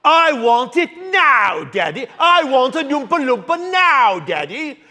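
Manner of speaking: put-on high, loud demanding child's whine; bratty shouted whine at daddy; spoiled brat whine. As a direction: stamp your foot like a spoiled kid demanding it now. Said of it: "From Willy Wonka".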